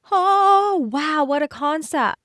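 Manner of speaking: sarcastic wow. Acted. Mock amazement at an obvious idea.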